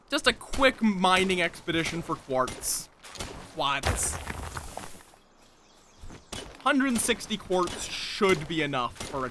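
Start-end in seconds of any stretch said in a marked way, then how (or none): 3.5-4.2 Boston accent. Shown as a demonstration. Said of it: "saying quartz in, like, a Bostonian accent".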